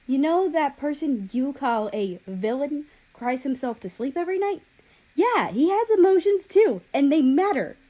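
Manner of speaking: rising to a loud, high furious outburst; angry exclaim. A narrator's reading. Japan defends her brother, loud and angry. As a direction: let the anger break out. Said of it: "she exclaimed in anger".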